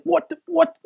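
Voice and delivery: high-pitched imitation of a stunned dad; dad's disbelief. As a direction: squeak it out like a dad who can't believe his eyes. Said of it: "my dad goes like this".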